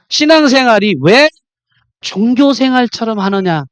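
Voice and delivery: usual speaking voice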